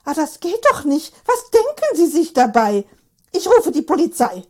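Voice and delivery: put-on high, loud indignant old woman; old lady threatening to call the police; outraged old lady. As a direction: shriek at him, scandalised. Old lady scandalised at the scene.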